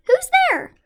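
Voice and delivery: high, loud startled mouse cry; frightened squeak. Acted. Little mouse startled by glowing eyes in the dark, loud high cry. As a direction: cry out in alarm like a tiny mouse. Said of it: "Mouse cries".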